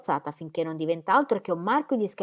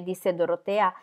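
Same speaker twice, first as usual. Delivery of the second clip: usual speaking voice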